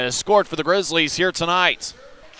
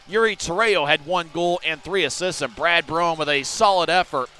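ordinary delivery

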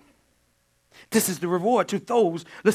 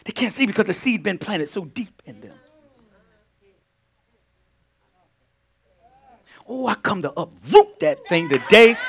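loud, high, ringing sermon delivery; full-force preaching; 5 semitones higher, 12 dB louder than usual. Real, not acted. Preacher drives the point home to the congregation at full force. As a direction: preach it out to the back row.

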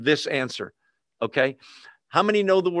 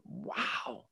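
whispered wow; 5 semitones higher, 14 dB quieter than usual. Real, not acted. Awed at quick answered prayer.

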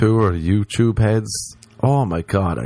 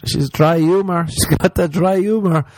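mock gushing; 8 semitones higher, 3 dB louder than usual. Mocking gushing about dry humor.

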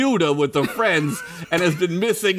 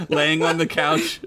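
normal talking voice

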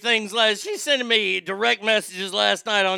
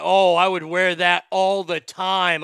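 slow, louder, drawn-out eager oh; eager yes; 6 dB louder, slower than usual. Real, not acted. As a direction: savor the idea, drawing it out. Enthusiastic reaction.

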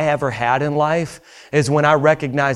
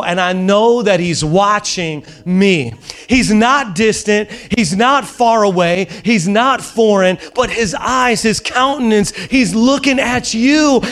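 fervent preaching; 6 semitones higher than usual. Acted. Pastor urging the congregation, fervent preaching. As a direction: preach it home to the crowd.

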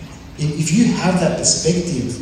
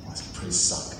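deflated aside; 10 semitones lower, 12 dB quieter than usual. Retold or imitated. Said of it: "I'm like".

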